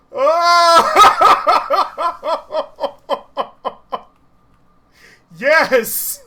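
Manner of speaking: real loud, high cackling whoops of triumph; can't-believe-it-worked belly laugh; triumphant laughter. Real, not acted. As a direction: burst out laughing, shouting between laughs. Rocket stunt works, streamer erupts.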